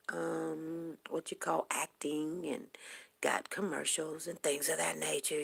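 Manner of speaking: normal talking voice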